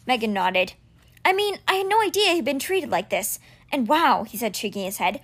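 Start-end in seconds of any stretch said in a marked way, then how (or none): 1.2-4.2 put-on higher, worried boy voice tumbling out; words flooding out of a frightened boy; anxious boy Alex. Boy telling his friend everything that's going wrong, anxious.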